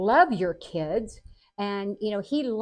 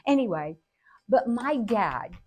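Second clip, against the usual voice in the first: usual speaking voice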